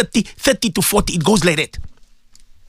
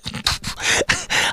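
soft laugh; 6 dB quieter than usual. Real, not acted. Amused at the apology.

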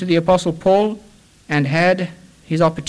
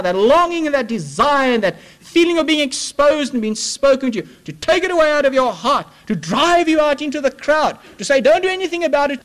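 high, rising sermon climax; impassioned preaching; 9 semitones higher than usual. Acted. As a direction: preach it with building fervor. Preaching at full force.

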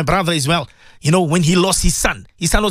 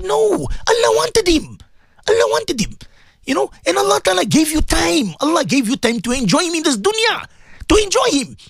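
higher, urgent pleading counsel; pleading consolation; 8 semitones higher than usual. Retold or imitated. Imam pleads with young widow to accept loss. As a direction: plead with her gently but urgently.

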